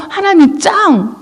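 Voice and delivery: high, gleeful cheer; put-on burst of grateful joy; acted happy praise. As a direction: cheer for God like a delighted fan. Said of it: "이러면서 좋아하고 감사했던".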